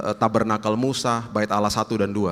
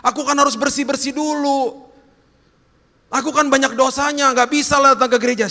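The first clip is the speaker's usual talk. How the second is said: high-pitched, pleading objection; hammed-up reluctant believer, voice pushed high; protesting sinner; 5 dB louder than usual. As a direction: protest your unworthiness to God. Acted sinner objecting to coming.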